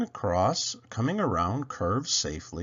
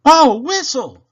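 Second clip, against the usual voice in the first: very high, loud cry; dismayed cry; 17 dB louder than usual. Acted. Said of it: "cried Toodle".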